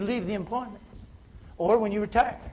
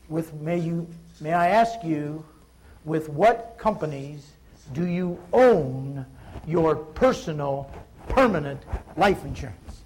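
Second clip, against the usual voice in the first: slow, weighted, low-pitched delivery; scripted question; 4 semitones lower, slower than usual. Shown as a demonstration. Agent putting the script's next question to a prospect, slow and deliberate. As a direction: spell out each phrase deliberately, pausing between them. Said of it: "next question in the script is this".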